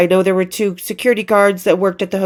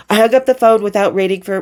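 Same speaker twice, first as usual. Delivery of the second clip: ordinary delivery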